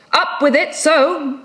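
high, sharp sea captain's command; called-out order. Acted. Ahab ordering sailors to heave the log, called out. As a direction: call it out high across the deck.

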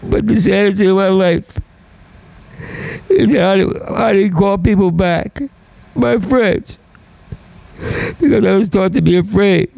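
raw, high, pained confession; near tears. Real, not acted. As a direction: let the voice crack as you confess it. Confessing his saddest regret.

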